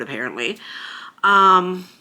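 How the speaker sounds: normal talking voice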